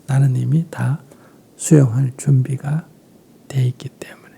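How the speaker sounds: ordinary talk, nothing marked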